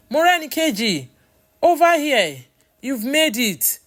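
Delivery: high, loud call to a friend; bright-pitched, projected greeting from afar. A narrator's reading. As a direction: shout a welcome across a crowded bus station. Greeting a friend across station.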